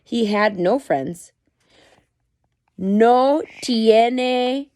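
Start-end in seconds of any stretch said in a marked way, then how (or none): 2.8-4.7 Spanish retelling. Shown as a demonstration. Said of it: "so for your English speakers".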